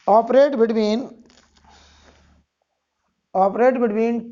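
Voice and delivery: slow, repeated dictation; dictating. Shown as a demonstration. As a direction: read each phrase out for copying. Dictating notes phrase by phrase.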